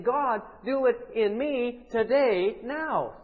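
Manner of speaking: loud, high, drawn-out plea to God; urgent prayer. Acted. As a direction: pray it with force, word by word. Preacher voices an urgent participant prayer.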